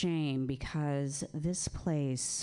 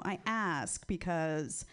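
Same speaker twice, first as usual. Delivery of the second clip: ordinary delivery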